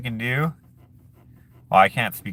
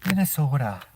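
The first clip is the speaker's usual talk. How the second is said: long rounded O in Minnesota; Minnesota accent; 4 semitones higher, 3 dB quieter than usual. Shown as a demonstration. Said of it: "heavy of an accent in Minnesota".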